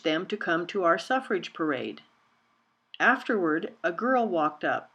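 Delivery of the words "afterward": ordinary delivery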